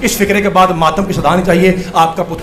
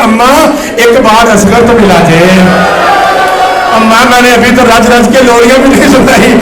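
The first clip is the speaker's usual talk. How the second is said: anguished pleading; 4 semitones higher, 7 dB louder than usual. Retold or imitated. Sister begging mother to see baby Asghar, imitated anguished wail. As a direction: cry out a sister's desperate plea. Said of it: "تڑپ کے صغریٰ کہتی ہے".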